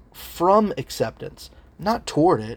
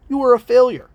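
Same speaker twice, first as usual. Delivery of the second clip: higher, louder acted accusation; accusing enemy; 10 semitones higher, 7 dB louder than usual. Inner enemy condemning the listener's soul, forcefully accusing. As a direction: condemn with sudden force and raised pitch. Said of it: "That is the voice of your enemy".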